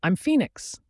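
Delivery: usual speaking voice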